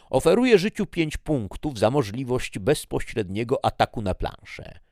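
a loud, high, showy declaration to the judge; Death's proclamation. Acted. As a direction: proclaim the offer like a ruling power. Death makes a formal offer.

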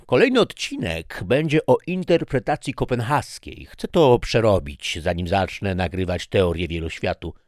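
eager lecture. Acted. Husband enthusing to wife about physics episode, eager. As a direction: rush on about your next topic.

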